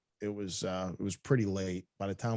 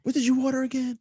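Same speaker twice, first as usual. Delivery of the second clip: mock cashier; 4 dB louder than usual. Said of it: "she was like".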